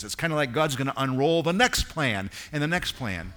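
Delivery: fervent preacher building to a climax; full-force preaching. Acted. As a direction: proclaim it from the pulpit. Opening stretch preached at full force.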